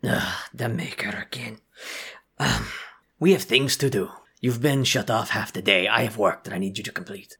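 a fed-up guard giving orders; irritated guard voice. Acted.

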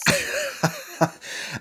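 high, awkward burst of laughter; nervous laugh. Real, not acted. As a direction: giggle nervously, caught off guard. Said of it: "that was a bit of a nervous laugh".

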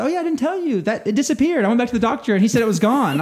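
a lightly voiced nonchalant patient; offhand woman. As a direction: toss it off like old news. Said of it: "She says".

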